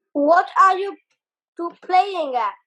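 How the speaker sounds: loud, high call across the water; yelled. A narrator's reading. Gills yelling at the two in the canoe, loud and high. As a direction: yell it at the dawdlers. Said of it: "Gills yelled".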